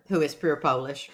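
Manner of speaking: normal talking voice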